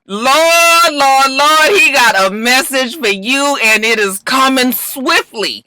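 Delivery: high, loud preacher-style wail; hammed-up church lady warning; preachy warning. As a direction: call down the Lord's warning. Mock preacher warning Bishop.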